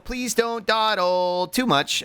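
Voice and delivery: slow, stretched nagging; slow drawn-out delivery. Shown as a demonstration. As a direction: draw each word out slowly. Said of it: "repeats the line".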